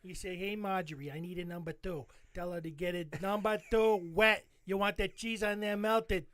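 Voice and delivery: Philly local giving ordering instructions; mock Philadelphia regular; Philadelphia accent. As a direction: give directions in a hammed-up Philly accent. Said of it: "You go up to anybody in Philly".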